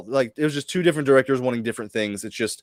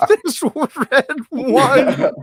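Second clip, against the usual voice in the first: high, giggling words; barely getting it out through laughter; laughing; 12 semitones higher, much slower than usual. Real, not acted. Host losing it over red wine in the shit, laughing.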